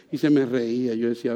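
usual speaking voice

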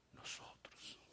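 a hushed whisper. Real, not acted. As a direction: drop to a secret whisper. Preacher's whispered answer.

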